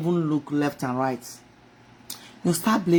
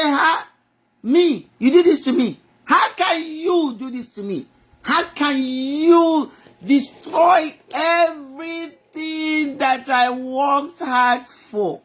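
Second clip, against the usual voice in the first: angry outcry to God; 5 semitones higher, slower than usual. Retold or imitated. To God, furious over everything lost, crying out. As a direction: rail at God through tears. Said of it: "I got so mad at God".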